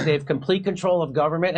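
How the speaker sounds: ordinary talk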